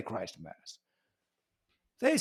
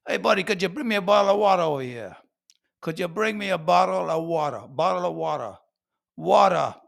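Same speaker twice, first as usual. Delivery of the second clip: brisk, R-dropping New Yorker; New York "wata", tossed at a buddy; New York accent; 3 semitones higher, 3 dB louder, faster than usual. Acted. New Yorker asking a buddy for water, New York accent. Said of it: "the New Yorkers, for example. They say".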